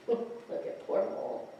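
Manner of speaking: ordinary delivery, nothing marked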